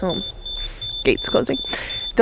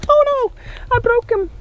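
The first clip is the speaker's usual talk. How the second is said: sudden high yelp of dismay; oops, it snapped; surprised; 13 semitones higher, slower than usual. Real, not acted. Carrot snaps while pulling it.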